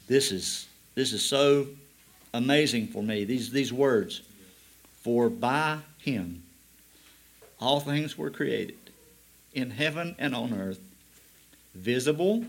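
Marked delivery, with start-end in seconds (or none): 5.0-12.4 slow, deliberate scripture reading; read it real slow. Shown as a demonstration. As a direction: lay each word down slowly. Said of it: "I want to read it real slow".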